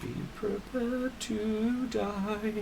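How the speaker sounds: slow, high menacing taunt; menacing villain. Acted. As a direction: draw out the threat slowly.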